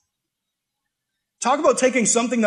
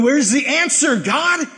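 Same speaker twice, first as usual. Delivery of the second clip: higher and louder, pleading toward heaven; anxious plea; 6 semitones higher, 3 dB louder, slower than usual. Retold or imitated. Anxious believer asking God for answers, raised pleading voice. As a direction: call up to God like a worried believer. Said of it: "waiting on God and going".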